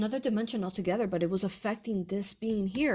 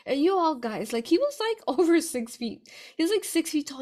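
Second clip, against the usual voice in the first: louder and higher, laughing disbelief; excited; 7 semitones higher, 6 dB louder than usual. Real, not acted. Telling viewers about the giant client, excited and loud. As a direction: tell the guys how huge he was, amazed. Marvels at the huge guy.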